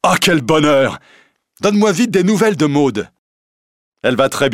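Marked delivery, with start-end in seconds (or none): 0.0-3.1 high, loud eager delight; bursting with happiness; joyful. A narrator's reading. Will overjoyed at the reunion, asking eagerly after Maude.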